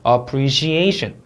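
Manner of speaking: "appreciation" slow, stress clearly marked; careful word stress. Shown as a demonstration. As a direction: lean on the A before -tion in "appreciation". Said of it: "강세 주의에서 한번 단어 읽어볼게요". ("appreciation" 강세 살려 천천히)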